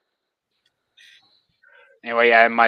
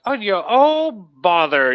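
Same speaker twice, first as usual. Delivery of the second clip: slow, higher cartoon bear impression; Winnie the Pooh; 7 semitones higher, slower than usual. Showing how the bear talks, slow high impression. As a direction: sigh it out high and drawn out. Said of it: "if you go and listen to ... Winnie the Pooh".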